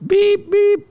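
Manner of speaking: two high, level beeps; high-pitched "beep, beep" like a phone; imitated phone beeps. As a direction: mimic phone keypad tones in a high pitch. Imitating phone dialing tones.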